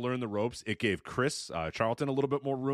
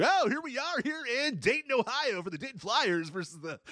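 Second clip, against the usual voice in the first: high, booming mock announcer voice; Syracuse big boy voice; 12 semitones higher than usual. Mocking an over-the-top college basketball announcer. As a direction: ham up a pompous TV play-by-play intro. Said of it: "He does have the Syracuse big boy voice".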